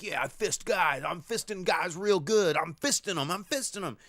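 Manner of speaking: high, loud, gleeful boasting; proud Fisto. Retold or imitated. Retelling Fisto bragging to the others, high gleeful boast. Voicing Fisto embracing the name.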